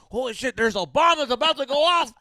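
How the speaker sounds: high, loud mock neighbor voice; mock panicked neighbor. Friend mocks nosy neighbors near the blast, high loud mock voice. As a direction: play the nosy neighbor freaking out.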